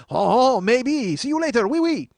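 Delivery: high, loud mock-French farewell; over-the-top French accent. French guy declining to pay, hammed-up French accent. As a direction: toss it off as a cartoon Frenchman. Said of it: "And he goes".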